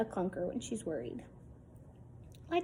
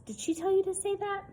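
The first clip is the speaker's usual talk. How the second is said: put-on much higher, suspicious kid question; suspicious boy; 10 semitones higher than usual. Boy asking his friend if Gwen put him up to it, high and suspicious. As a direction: probe like a little detective.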